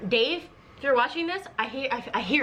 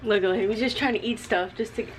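ordinary talk, nothing marked

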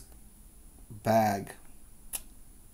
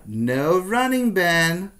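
lightly voiced teacher scolding, higher and louder; a hint of a stern teacher; 5 semitones higher, 5 dB louder, slower than usual. Miss Green telling Ben not to run, stern. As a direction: warn him off like a strict teacher. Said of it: "says Miss Green".

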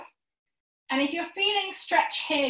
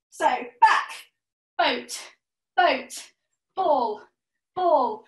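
calling out poses; much slower than usual. Shown as a demonstration. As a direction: bark each pose like a game call. Calls poses for the exercise game.

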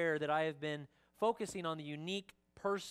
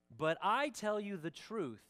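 slow, raised pitch with weight on "I tell"; emphatic scripture quote; 6 semitones higher, 3 dB louder than usual. Acted. Pastor reads Jesus stressing a statement to disciples, emphatic. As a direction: lean hard on "I tell you", slowly. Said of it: "He's emphasizing this next statement".

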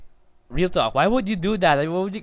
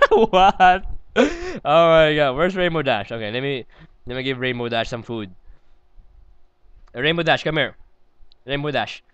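ordinary talk

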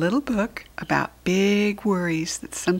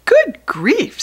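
high, loud gasp of surprise; a startled parent's good grief; surprised; 12 semitones higher, 10 dB louder than usual. Acted. Parent reading to children, a high startled exclamation. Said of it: "said Large".

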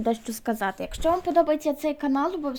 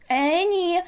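a higher, indignant exclamation; exclaiming; 5 semitones higher, 4 dB louder than usual. A narrator's reading. Anna-Liza refuses to sit next to Flo, indignant cry. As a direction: refuse it out loud, offended. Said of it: "вигукнула вона".

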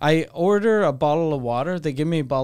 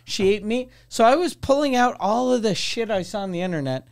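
usual speaking voice

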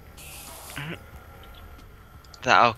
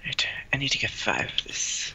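whispering to self; 8 dB quieter than usual. Real, not acted. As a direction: murmur it under breath. Whispered to self while crafting.

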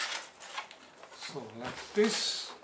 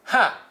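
sharp laugh; 7 semitones higher, 13 dB louder than usual. Real, not acted. Triumphant laugh of discovery.

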